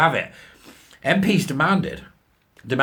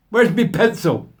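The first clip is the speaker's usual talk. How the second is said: daft character voice; 8 semitones higher, 5 dB louder than usual. Pretend.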